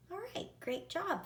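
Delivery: bright, high, quick praise; teacher praise. Real, not acted. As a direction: cheer the class on. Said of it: "praising the class".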